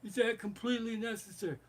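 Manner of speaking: imitated mob boss, high reluctant question; Godfather. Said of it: "Godfather doesn't wanna see".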